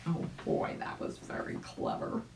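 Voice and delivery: a hushed, admiring aside; whispered aside. A narrator's reading. Narrator whispering an aside to the child. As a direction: whisper it softly to the listener.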